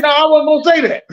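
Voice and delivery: loud, high-pitched comic denial; mock protest. Co-host imitating boss's protest at autocorrect, mock panic. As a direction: act out a panicked backpedal, laughing it up. Acts out protest at auto-sent firing.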